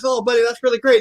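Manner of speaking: put-on lifted grandpa voice to a little boy. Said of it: "I said".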